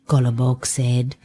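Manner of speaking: ordinary delivery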